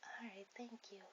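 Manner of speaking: soft whispered thanks. Acted. Girl on a library date whispers thanks to her date. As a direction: whisper it so the library stays quiet.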